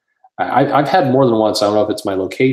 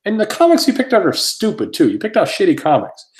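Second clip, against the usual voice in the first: high-pitched indignant rant, imitated; retold pissed-off; 11 semitones higher, 4 dB louder than usual. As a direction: berate him in a raised, exasperated voice. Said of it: "somebody goes over and gets pissed".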